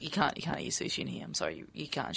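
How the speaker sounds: low, soft apologetic telling-off; imitated quiet refusal. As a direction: mimic a discreet, awkward objection. Cafe man apologetically refusing, retold.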